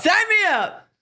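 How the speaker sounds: high squeaky put-on voice; mock character voice. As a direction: pitch it up like a cartoon.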